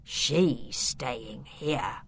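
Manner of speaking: very slow, low villain voice; menacing uncle. A narrator's reading. The Count keeps Beatrice prisoner, menacingly. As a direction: draw out the verdict deep and cold. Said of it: "his eyes glinting".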